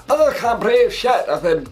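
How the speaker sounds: high, strained Scottish voice as Jon gasping under the crowd; a hammed-up Scottish Jon Snow who can't breathe; Scottish accent. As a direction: do Jon in a mock Scottish accent, crushed and short of air. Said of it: "I don't know why he was Scottish".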